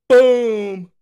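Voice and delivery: loud, high boom; excited shout. Real, not acted. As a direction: shout it out triumphantly. Reacting to the AI's answer.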